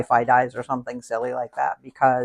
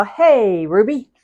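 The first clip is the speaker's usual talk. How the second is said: ordinary talk, nothing marked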